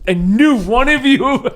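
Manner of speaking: giggling through the words; laughing. Real, not acted. Game master laughing at his own recap. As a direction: laugh it out, high and slow.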